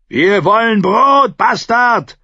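loud, high, drawn-out yell; demanding shout. A narrator's reading. Hungry man to the king, shouting demands. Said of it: "verlangte der Mann hinter ihr".

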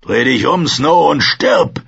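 loud, rising, slowing threat; shouted challenge. Acted. Ranger turns on the young man to fight, shouting.

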